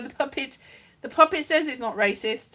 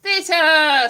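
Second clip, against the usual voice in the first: grand declaiming; 3 semitones higher, 7 dB louder than usual. Acted. Declaiming the word theatre.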